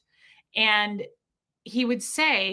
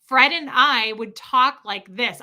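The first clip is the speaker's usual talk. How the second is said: slow, louder demonstration for a lip-reader; slowed-down talk; 5 dB louder than usual. Shown as a demonstration. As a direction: mouth each word slowly and firmly. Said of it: "I would slow down".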